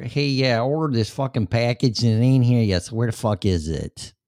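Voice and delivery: lightly voiced angry New York customer; mock New York caller. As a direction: imitate an irate New Yorker on the phone, lightly. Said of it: "New York".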